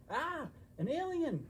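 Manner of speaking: high startled cry at an alien; alarmed shout. Acted. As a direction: jump with fright and shout. Spotting alien in forest.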